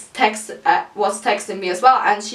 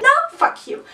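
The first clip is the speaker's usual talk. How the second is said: much higher, snapped mock retort; friend's retort; faster than usual. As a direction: fling it back like the angry friend.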